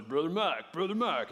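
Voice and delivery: imitating a guy trying to out-man you; lightly mocking manly friend voice; macho buddy voice. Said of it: "he talks like this all the time".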